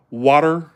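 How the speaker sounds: "water" with the final R sounded; Southern "water", R kept; Southern pronunciation. Shown as a demonstration. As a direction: say "water" ending in a clear R. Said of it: "In the South".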